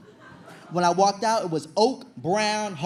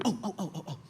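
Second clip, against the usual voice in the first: quivering moans; 7 dB quieter, faster than usual. Retold or imitated. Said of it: "Anita starts quivering".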